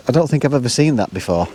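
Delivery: ordinary delivery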